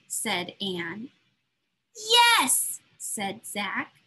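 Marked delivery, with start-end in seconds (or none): 1.9-2.8 excited yes. Acted. Zach's joyful answer.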